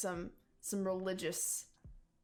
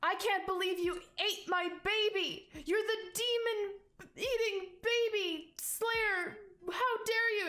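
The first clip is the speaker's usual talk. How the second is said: high, outraged woman's accusation; shrieky, distraught rabbit woman; hysterical shouting; 11 semitones higher, 4 dB louder than usual. Acted. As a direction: accuse like a frantic mother, pitch climbing. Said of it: "shouting, like, in, like, just nonsense at you".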